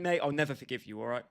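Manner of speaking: normal talking voice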